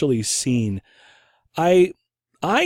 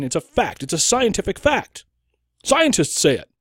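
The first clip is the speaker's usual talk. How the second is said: high-pitched badgering, climbing with each claim; acted indignant certainty in a raised pitch; mock insistence; 9 semitones higher than usual. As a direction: imitate a pushy accuser hammering the point. Acts insistent accuser pressing false fact.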